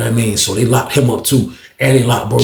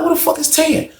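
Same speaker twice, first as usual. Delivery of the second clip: high, baffled demand; retold exasperation. Storyteller still waiting on his missing friend, retold exasperation. Repeats the search question.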